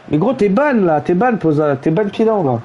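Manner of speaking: ordinary delivery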